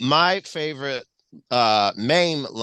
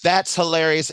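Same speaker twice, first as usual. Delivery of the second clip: normal talking voice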